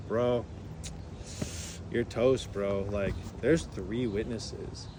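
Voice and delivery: low, slow, softened bro voice; mock-grave slacker passenger; imitating rowdy passenger. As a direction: drop low and drag out an ominous warning. Imitates Ricky's deadpan warning.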